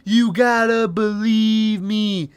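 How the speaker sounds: high, drawn-out mock plea; hammed-up desperate imitation; begging. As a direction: plead hard, stretching the words. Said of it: "where he's begging her".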